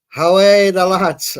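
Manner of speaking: loud, drawn-out bad Geordie accent; accidental Geordie accent. Real, not acted. Reading a Geordie viewer's chat message, in an accidental bad Geordie accent. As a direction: let a horrible Geordie accent slip out, loud and stretched. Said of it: "that wasn't an attempt at a Geordie accent".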